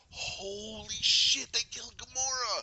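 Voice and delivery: high, drawn-out, loud disbelief; stunned shock. Retold or imitated. Viewer reliving Gamora's death, stunned exclamation. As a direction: stretch it out slowly, voice climbing high.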